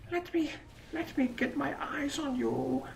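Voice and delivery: high, slow, hushed stalker voice; creepy sniper whisper. Pretend. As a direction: whisper to the target slowly as if stalking it.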